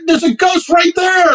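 very high mock-terrified cry; mock screaming. Roommate insisting on the ghost, mock screaming. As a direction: shriek it high without much extra volume. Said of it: "he starts screaming".

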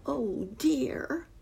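high-pitched "oh dear"; plaintive exclamation. A narrator's reading. Unseen voice from the bushes, plaintive exclamation. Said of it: "a voice exclaimed".

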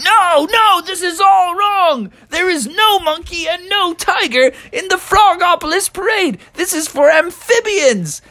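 a fussy frog insisting on the rules; indignant high Froggy. Acted. Froggy objects to monkey and tiger, high and squeaky.

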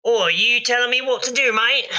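sass back like a cocky goblin; cheeky goblin voice. Acted. Goblin sassing the GM.

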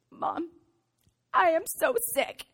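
lightly voiced pitiful little kid; mock-pitiful child's complaint; sick child. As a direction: play a poorly child, pausing after Mom. Imitating her sick five-year-old.

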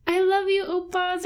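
high-pitched gushing fan voice; mock fangirl declaration of love; imitating a fangirl. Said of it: "there's also a girl who calls B T S".

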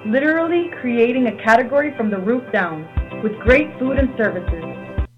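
phone menu voice. Real, not acted. Recorded pizza chain ad.

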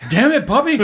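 high, loud Texan twang; Hank Hill impression. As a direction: yell like an exasperated cartoon dad. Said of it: "What if Hank Hill just walked out".

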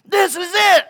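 high, loud, hammed-up triumph; acted outburst of excitement; elated shout. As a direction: shout it like your big moment has come. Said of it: "Jose's like".

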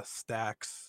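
ordinary talk, nothing marked

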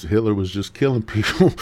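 ordinary delivery

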